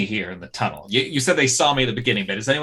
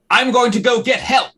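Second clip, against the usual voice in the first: high, raised, urgent cry; loud yell; 12 semitones higher, 7 dB louder than usual. Acted. Player's character yelling from the shadows, loud and high. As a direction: yell it out as a decoy. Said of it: "I'm going to yell loudly".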